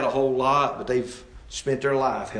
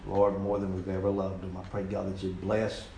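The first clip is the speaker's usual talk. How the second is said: lower, subdued prayer voice; closing prayer; 5 semitones lower, 4 dB quieter than usual. Real, not acted. Preacher asking God to help the congregation, subdued prayer. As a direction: pray earnestly and quietly to close.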